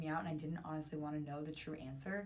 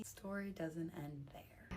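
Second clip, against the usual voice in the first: whisper; 7 dB quieter, much slower than usual. Real, not acted. Teaser at the story's end.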